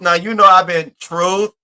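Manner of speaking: usual speaking voice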